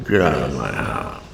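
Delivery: low, soft attempt at gritted-teeth anger. Shown as a demonstration. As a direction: keep it quiet, teeth clenched. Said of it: "that's how it would go".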